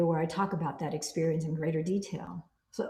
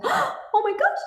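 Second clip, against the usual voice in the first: high, loud mock panic on Oh my gosh; 5 dB louder, slower than usual. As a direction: squeal it like you're panicking. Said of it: "instead of it going".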